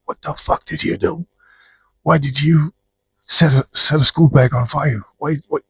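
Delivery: high-pitched furious mom scolding; angry mom. Retold or imitated. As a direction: scold like a livid mother. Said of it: "your mama's really mad".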